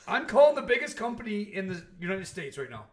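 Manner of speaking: loud, indignant retold complaint; retold exasperation. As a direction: raise your voice like a fed-up caller. Retells his exasperated complaint call.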